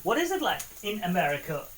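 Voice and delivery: ordinary talk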